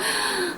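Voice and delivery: big audible test gasp; breath-check gasp. Shown as a demonstration. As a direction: demonstrate gulping a breath to check. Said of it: "And then I'd go".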